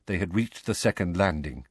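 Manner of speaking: ordinary delivery, nothing marked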